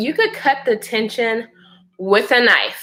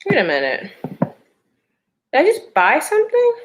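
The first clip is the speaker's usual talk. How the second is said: startled aside; faster than usual. Real, not acted. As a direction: catch yourself mid-story, startled. Sudden startled aside mid-story.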